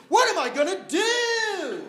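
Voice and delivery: high, loud, slowed wail; mock despair. Comic abandoned onstage, loud mock wail. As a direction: cry out in hammed-up despair.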